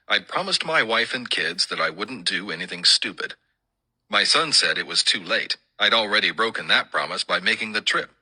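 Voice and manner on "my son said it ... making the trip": usual speaking voice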